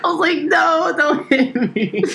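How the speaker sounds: high, laughing mock fear; mock panic. Teen in dodgeball class squealing for the balls to stop. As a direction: squeal it like you're dodging balls.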